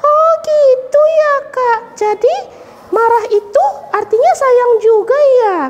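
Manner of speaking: put-on high, slow dawning child voice; puppet girl Mimi. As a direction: realize it like a small girl. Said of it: "Mama dan Papa sayang".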